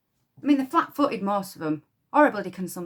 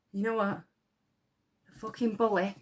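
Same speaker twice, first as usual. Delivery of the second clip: normal talking voice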